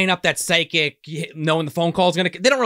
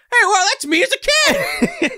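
very high, slow, goofy character voice; co-host voicing the man in the flashback; silly high voice; 4 dB louder than usual. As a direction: squeak it out like a cartoon.

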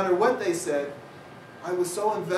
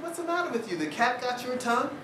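postmistress; 8 semitones higher than usual. Retold or imitated. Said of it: "she said".

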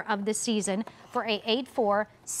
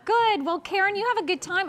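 cheery high sign-off; 7 semitones higher than usual. Real, not acted. Cheerful goodbye to caller.

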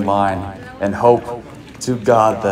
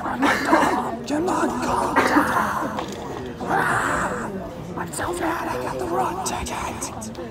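squeaky critter voice; 5 dB quieter, slower than usual. Pretend. Squeaky silly voice, dog.

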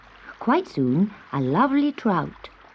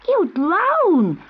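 put-on high, loud little girl in alarm; alarmed cry; 11 semitones higher, 5 dB louder than usual. A narrator's reading. Girl sees a kitten in the river, alarmed high cry. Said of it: "cried one of the girls".

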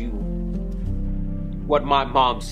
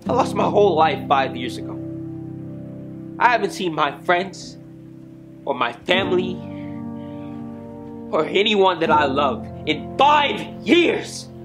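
raised pitch, building to an outcry; anguished protest; 9 semitones higher than usual. Acted. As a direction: spell out the loss with pained pauses. Accused character pleads his loss.

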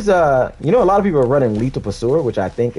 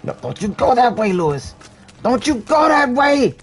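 yelling; 14 semitones higher than usual. Real, not acted. Yelling at teammate in game.

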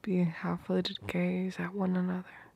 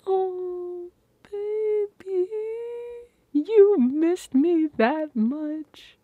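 much higher, slow, melting coo; tender cooing; 12 semitones higher, 7 dB louder, much slower than usual. Acted. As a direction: melt at how much she missed you. Touched by partner missing him.

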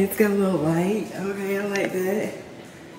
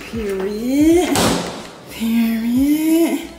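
high, loud emphatic stamp; emphatic approval; 7 semitones higher, 7 dB louder, much slower than usual. Real, not acted. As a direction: land it like a final verdict. Ice maker delight.

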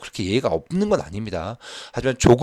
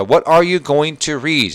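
very slow, louder model read; slow second reading; 6 semitones higher, 6 dB louder, much slower than usual. Shown as a demonstration. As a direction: read it again slowly for the class. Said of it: "두 번째 읽어볼게요". (천천히 다시 읽기)

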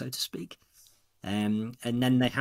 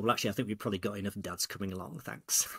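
quick, softer, understated letdown; retold disappointment; 3 semitones lower, 6 dB quieter, faster than usual. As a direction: imitate a let-down son politely brushing Dad off. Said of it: "looked at me very disappointedly".

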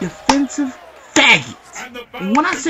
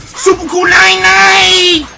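slow, louder shouted move name; a hyped special-move call; move callout; 5 semitones higher, 5 dB louder, slower than usual. Real, not acted. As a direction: yell the move name out slowly.

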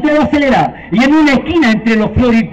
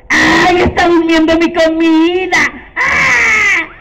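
excited witch; 4 semitones higher, slower than usual. Acted. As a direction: squeal over the sleeping meal. Witch excited over sleeping food.

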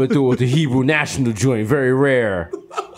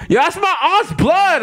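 much higher and slower, through laughter; laughing; slower than usual. Real, not acted. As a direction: squeak it out while cracking up. Joking about hospital hookah.

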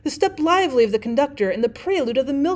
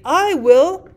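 firm, louder and higher declaration; emphatic, raised-voice assertion; unhesitating; 6 semitones higher, 7 dB louder than usual. A narrator's reading. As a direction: answer at once, with no doubt. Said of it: "Chicago says unhesitatingly".

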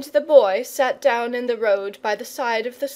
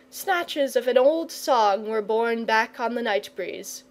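ordinary delivery, nothing marked

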